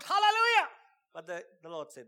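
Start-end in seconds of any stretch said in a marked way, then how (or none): 0.0-0.7 much higher, loud cry of praise; shout. Retold or imitated. The shout of praise he held back, belted high. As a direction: belt out the hallelujah. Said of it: "I wanted to shout".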